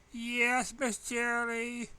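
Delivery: high, slow, sugary chime; too sweet. A narrator's reading. Student answering teacher with fake sweetness, high slow chime. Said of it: "she chimed all too sweetly".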